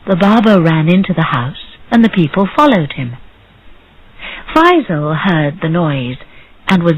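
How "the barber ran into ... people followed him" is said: usual speaking voice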